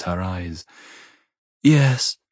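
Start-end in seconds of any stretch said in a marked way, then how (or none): 1.6-2.2 high, breathed woman's reply; a higher, lightly voiced breathy yes. A narrator's reading. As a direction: breathe it out, lifted in pitch. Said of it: "she breathes".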